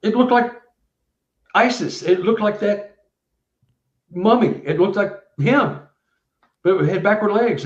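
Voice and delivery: a witness describing what he saw; lightly voiced startled witness; excited witness. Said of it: "He said".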